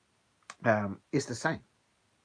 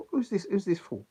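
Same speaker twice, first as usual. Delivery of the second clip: mock-indignant Jamaican patois, raised pitch; lightly put-on Caribbean patois, higher voice; patois accent; 7 semitones higher than usual. Offended Caribbean lady sizing up a customer, in patois accent. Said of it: "In their patois accent".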